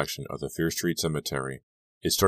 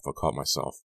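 usual speaking voice

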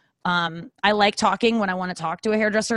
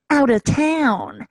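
ordinary talk

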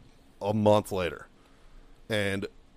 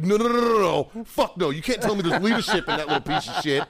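outraged refusal; 7 semitones higher than usual. Retold or imitated. Marine rejecting first sergeant's excuse, outraged refusal. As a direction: cut him off with indignant disbelief.

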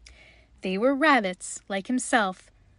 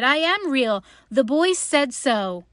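raised, louder little rabbit protest; higher, earnest storybook insistence; insistent protest; 4 semitones higher, 6 dB louder than usual. A narrator's reading. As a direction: plead your case emphatically. Little rabbit protests, nearly crying.

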